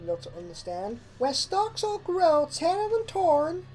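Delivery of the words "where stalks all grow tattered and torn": high filly voice reciting; reciting a rhyme. A narrator's reading. Apple Bloom reciting her sister's rhyme, high filly voice. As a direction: recite it like a learned nursery rhyme. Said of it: "Apple Bloom recited the rhyme".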